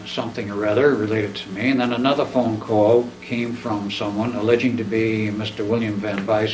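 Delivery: usual speaking voice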